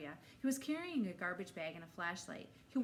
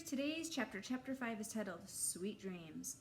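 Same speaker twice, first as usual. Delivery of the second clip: bright teacher voice greeting the class; cheerful read-aloud opening; reading to children; 5 semitones higher than usual. A narrator's reading. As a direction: storytime voice for first graders.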